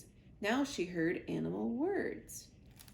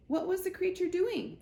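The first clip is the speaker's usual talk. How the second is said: 1 curious weasel; 9 semitones higher, 3 dB louder than usual. Acted. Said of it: "said Dart the Weasel".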